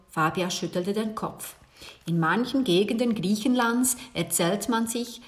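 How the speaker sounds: usual speaking voice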